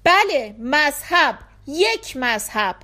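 narrated shout of agreement. A narrator's reading. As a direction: call it out emphatically. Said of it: "همزمان فریاد زدند".

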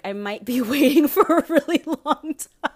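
high, loud, helpless laughing speech. Real, not acted. Podcaster admitting she would wait forever, cracking up. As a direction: crack up until the words give out. Cracks up at her own remark.

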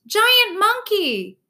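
high, loud terrified screech; cat screams at the sight of the gorilla; screaming cat. A narrator's reading. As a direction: shriek it in panic. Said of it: "screams the orange cat".

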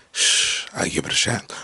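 hushed, voiceless aside; playful whisper. Acted. Man confiding a celebrity crush, playful whisper. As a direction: whisper it like a cheeky confession.

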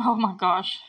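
usual speaking voice

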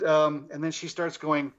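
ordinary delivery

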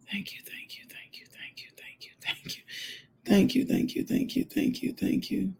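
hushed repeated thanks, swelling toward the end; rapid breathy praise building; whispered prayer. Real, not acted. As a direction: start barely audible, let the thanks rise. Whispered thanks, growing more audible.

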